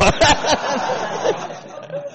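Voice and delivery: very high rising laugh; laughing at own punchline; shrieking laughter. Real, not acted.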